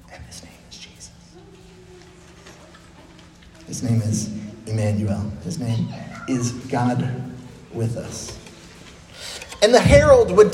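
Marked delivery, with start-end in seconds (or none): 0.1-8.4 whispered, soft name reveal; hushed reveal. Retold or imitated. Angel's announcement, intimate reveal.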